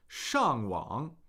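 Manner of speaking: 上网 with a falling 上 and a dipping, rising 网; 上网: fall on 上, dip then rise on 网; tone demo. Shown as a demonstration. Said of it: "fourth tone, third tone".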